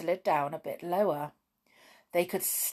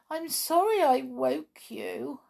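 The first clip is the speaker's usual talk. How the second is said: put-on high, meek little-girl apology; acted plaintive little voice; tearful child; 8 semitones higher than usual. A narrator's reading. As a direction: apologise timidly in a small child's pitch.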